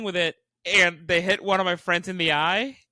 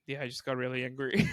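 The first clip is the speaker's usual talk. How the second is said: subdued; 6 semitones lower, 5 dB quieter than usual. Retold or imitated. Friend calmed down after outburst.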